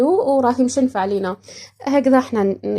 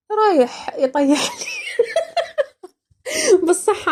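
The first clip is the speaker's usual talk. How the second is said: laughing; 10 semitones higher, slower than usual. Real, not acted. Laughing at the joke.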